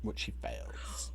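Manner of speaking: hushed, deadpan reveal; whispered aside. A narrator's reading. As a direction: whisper the punchline. GM punchline aside.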